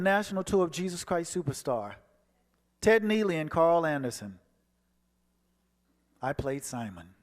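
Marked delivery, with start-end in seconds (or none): none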